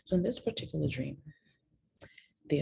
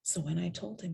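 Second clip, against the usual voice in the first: ordinary talk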